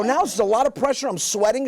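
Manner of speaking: high, pressured imitation; mock panic. Husband under pressure from pregnant wife, mock flustered.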